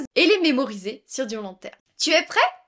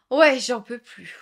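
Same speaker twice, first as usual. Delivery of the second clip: exhausted whisper; 5 dB louder than usual. Acted. Acted tired reply in example.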